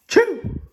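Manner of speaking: high-pitched "choo" burst; acted sneeze. Gerald sneezes in the story.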